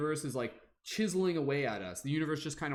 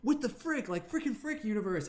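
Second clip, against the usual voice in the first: much higher, squeaky outraged cry; indignant squeal; 9 semitones higher than usual. Acted. You complaining to the universe, high squeaky outrage. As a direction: screech it like a startled kid. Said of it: "you're just like".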